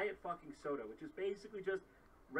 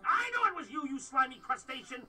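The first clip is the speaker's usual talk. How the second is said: angry; 7 semitones higher, 8 dB louder than usual. Acted. Mock accusation in a sketch.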